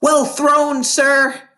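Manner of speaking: high, loud call from afar; a bystander cheering the throw from far away; shouted cheer. A narrator's reading. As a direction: cheer it across the playground. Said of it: "someone shouted from across".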